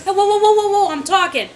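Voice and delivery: angry shout. Retold or imitated. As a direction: shout down the interruption. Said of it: "And he goes".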